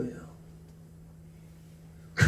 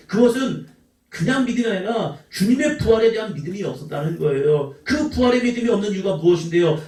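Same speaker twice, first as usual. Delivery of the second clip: ordinary delivery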